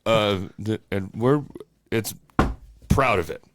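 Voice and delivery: hint of a stumbling president impression; Joe Biden. Co-host spoofing the president losing his thread, halting impression. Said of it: "that's a good Joe Biden impression".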